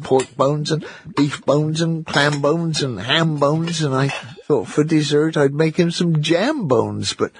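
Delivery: high, fussing mother's voice; fretting mother. A narrator's reading. Mother frets that supper's ready but Jonathan's gone, high fussing voice. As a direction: flutter about like a worried mum.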